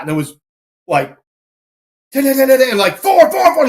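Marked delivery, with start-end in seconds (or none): 2.1-2.7 high, loud volley of dings; imitated plate dings. Shooter acting out the second run, imitated dings. 3.0-3.7 very high, loud repeated count; imitated screaming. Range officer screaming the missed plate number at shooter, imitated. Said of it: "scream in those numbers".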